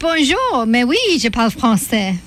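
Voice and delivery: fluent, lilting French; French accent. Shown as a demonstration. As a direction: show off real French pronunciation. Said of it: "'cause I speak French".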